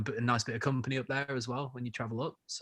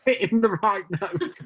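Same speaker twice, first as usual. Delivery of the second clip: laughing tease; 11 semitones higher, 7 dB louder than usual. Real, not acted. Teasing the pun, laughing.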